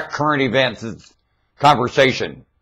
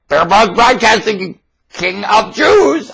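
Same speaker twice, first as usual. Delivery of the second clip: grand proclamation; 7 semitones higher, slower than usual. Acted. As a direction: announce the title like a herald. Triumphant proclamation of his title.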